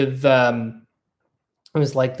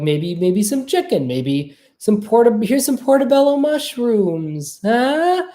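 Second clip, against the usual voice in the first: mock eager offering; 7 semitones higher than usual. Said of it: "I could be like".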